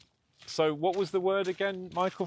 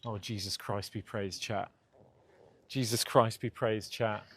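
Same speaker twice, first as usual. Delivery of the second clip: hushed; 5 semitones lower, 8 dB quieter than usual. Real, not acted. Hushed aside to chat.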